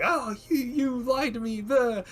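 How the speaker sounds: squeaky high mock-hurt sister voice, played for laughs; mock betrayed sister. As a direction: pitch way up to mock the whining sister.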